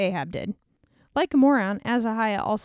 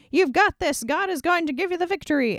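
cheerleader hype; 8 semitones higher than usual. Acted. False prophets cheering king.

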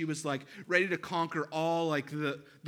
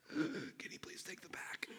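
slow, breathless whisper; husband finally surrendering the pack to his wife; defeated whisper; 5 semitones higher, 12 dB quieter than usual. Retold or imitated. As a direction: beg for help with the last of your air. Exhausted hiker gives up.

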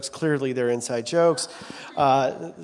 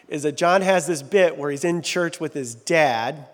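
usual speaking voice

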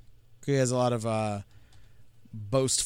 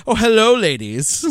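a higher, louder leering greeting; lecherous Franklin; 8 semitones higher, 9 dB louder than usual. Retold or imitated. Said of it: "he goes".

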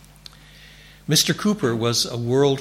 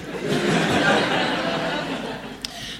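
real laughter. Real, not acted. Laughing at own story.

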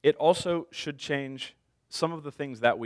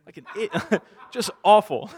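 real laughter breaking through the words; laughing at himself; 7 semitones higher, 7 dB louder than usual. Real, not acted. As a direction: laugh it out, embarrassed. Laughing at his own blunder.

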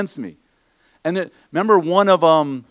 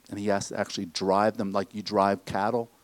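usual speaking voice

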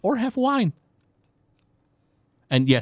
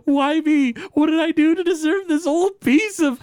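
much higher, mock whining; mock whiny self-pity; 3 dB louder than usual. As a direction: whine it like a pitiful child.

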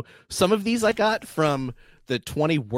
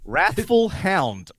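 slow announcement; 5 semitones higher, much slower than usual. Acted. Quiz host announcing spell.

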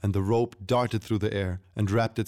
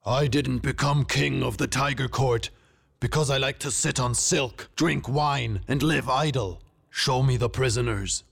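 raised, indignant royal voice; roaring king; 5 semitones higher than usual. A narrator's reading. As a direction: rebuke your men as an angry tiger king. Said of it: "the king's voice roared".

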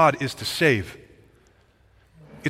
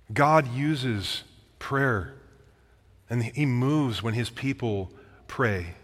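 slow emphatic point; much slower than usual. Real, not acted. Sermon point, slowed delivery.

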